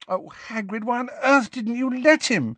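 high-pitched despairing question; distraught reproach, pitched high; exasperated dismay. A narrator's reading. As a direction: implore him in high exasperation. Exasperated Hermione questions Hagrid.

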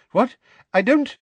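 high, loud bafflement; baffled. A narrator's reading. Minister not understanding the confession, baffled. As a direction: blurt it out, high and lost.

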